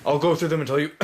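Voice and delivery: hoarse, failing voice; losing my voice. Real, not acted. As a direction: rasp through a tired throat. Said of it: "I'm losing my voice".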